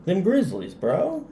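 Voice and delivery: bro voice. Acted. Hyping Montana against friend's pick.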